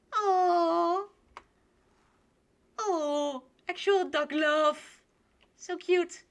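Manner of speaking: high, drawn-out gushing; adoring coo. Real, not acted. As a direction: coo over something very cute. Cooing over the duck romance.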